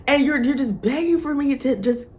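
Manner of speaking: usual speaking voice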